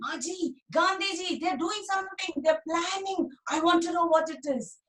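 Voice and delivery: put-on high, pleading child's voice; eager little boy. Dhani pestering his busy mother about the meeting. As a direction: tug at mother's sleeve with your voice.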